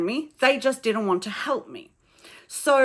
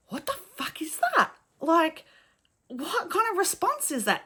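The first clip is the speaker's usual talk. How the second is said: stunned disbelief; 8 semitones higher than usual. Retold or imitated. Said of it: "And I was like".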